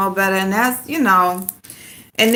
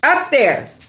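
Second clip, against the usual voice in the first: raised, commanding order; firm command; 5 semitones higher, 4 dB louder, slower than usual. Real, not acted. Owner ordering her dog upstairs, firm raised command. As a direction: send the dog upstairs firmly.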